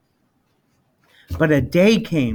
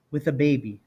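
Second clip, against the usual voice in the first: usual speaking voice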